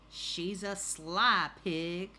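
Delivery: grumpy neighbor. Acted. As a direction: stretch it out as the disapproving storybook neighbor. Grumpy neighbor, voiced in story.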